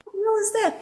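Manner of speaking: very high startled squeal; startled mock alarm. Woken by debris from the ceiling fan, high startled cry. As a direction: jump at the noise. Retelling fright at falling debris.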